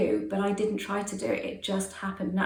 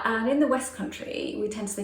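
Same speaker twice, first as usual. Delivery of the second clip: ordinary talk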